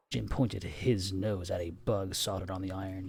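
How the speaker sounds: plain reading voice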